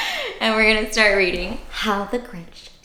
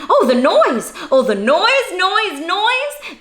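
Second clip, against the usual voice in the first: exasperated noise; 10 semitones higher, 4 dB louder, slower than usual. Acted. Grinch hating the noise.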